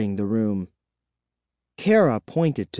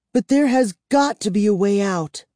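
determined; 9 semitones higher, 3 dB louder than usual. Acted. Kara insists despite fear.

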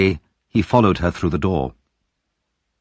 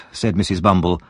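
ordinary delivery, nothing marked

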